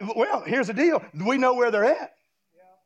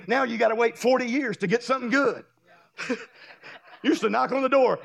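ordinary delivery

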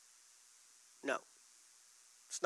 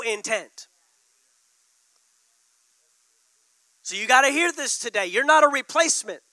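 louder and higher, driving the point home; full-force preaching; 5 semitones higher, 6 dB louder than usual. Acted. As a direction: preach it hard to the congregation.

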